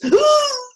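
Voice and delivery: high, loud startled gasp; sharp frightened gasp. Shown as a demonstration. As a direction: gasp as if a spider appears. Acting out fear of spiders.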